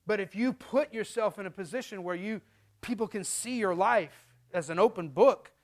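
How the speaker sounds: ordinary talk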